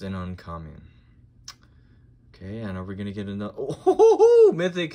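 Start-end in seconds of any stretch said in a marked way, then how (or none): 3.6-4.9 high, loud laughing "ho ho ho"; thrilled, laughing outburst, much higher and louder; real excited whoop. Real, not acted. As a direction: whoop with delight at a great find. Reacts to pulling a mythic card.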